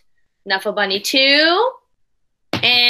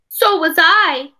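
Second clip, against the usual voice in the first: a hint of a higher, slower child; Trixie agreeing; 6 semitones higher, 4 dB louder, slower than usual. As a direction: answer as a small girl, earnestly. Trixie answers Sonia.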